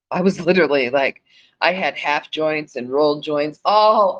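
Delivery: loud, high, laughing through the words; laughing while telling. Real, not acted. Telling listeners about smoking all day, loud and laughing. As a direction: giggle out the memory of joints everywhere.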